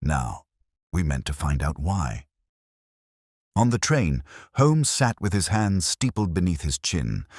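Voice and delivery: ordinary delivery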